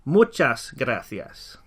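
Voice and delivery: "Muchas gracias" at half speed; teacher drilling the phrase; slow model. Shown as a demonstration. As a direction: lay out "Muchas gracias" slowly. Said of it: "as we say the words in Spanish".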